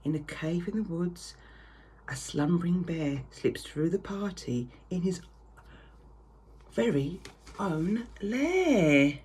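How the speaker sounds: ordinary delivery, nothing marked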